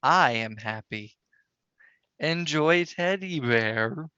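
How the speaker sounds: slow, drawn-out numbed speech; halting, slurred and sluggish; slightly slurred. Acted. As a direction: stretch the words out as if groggy. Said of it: "you may find your voice a little slurred".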